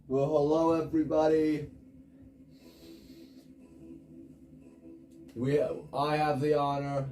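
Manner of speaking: mock commentator. Gamer playing TV commentator for New Zealand match, mock-grand. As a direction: introduce the match like a grand broadcast.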